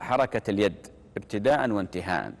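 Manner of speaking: usual speaking voice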